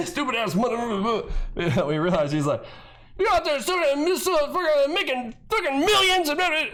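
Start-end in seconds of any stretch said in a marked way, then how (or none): 0.1-1.6 high-pitched, laughing through the words; mock the ranter while cracking up; giggly, higher echo of a rant; laughing mimicry. Mocks clip while laughing. 3.2-6.7 high garbled imitation of an upset ranter; high-pitched spluttering mock rant; mock screaming. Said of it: "he's screaming and upset".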